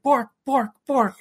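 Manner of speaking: high, loud bork bork; imitated dog bark. Voicing the dog Ginger, high barking. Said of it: "Ginger's like".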